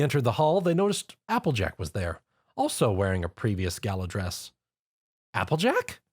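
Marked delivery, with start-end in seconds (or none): 5.3-6.0 bright high call across the room. A narrator's reading. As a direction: call out to a friend. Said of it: "Twilight called out".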